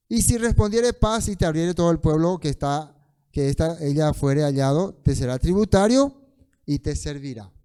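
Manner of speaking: loud, forceful Bible verse; scripture reading. Acted. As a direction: read the law out to the whole room. Relee el versículo en voz alta. (lectura bíblica en voz alta y firme)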